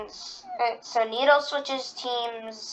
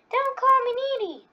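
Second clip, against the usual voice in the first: much higher, squeaky angry cartoon girl; Needle impression; 13 semitones higher than usual. Doing Needle for viewers, a much higher angry yell. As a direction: shriek it high like an offended cartoon needle. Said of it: "I'm gonna do my Needle impression".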